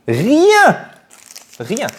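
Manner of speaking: high, loud "Rien!"; emphatic exclamation. Real, not acted. Unboxer insisting he avoided all spoilers, high emphatic shout. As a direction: cry it out to insist.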